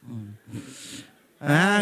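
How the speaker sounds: ordinary talk, nothing marked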